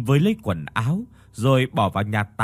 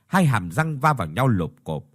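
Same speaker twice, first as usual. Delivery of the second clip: ordinary delivery, nothing marked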